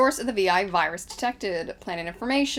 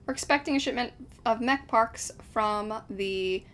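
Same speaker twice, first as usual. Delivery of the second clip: ordinary talk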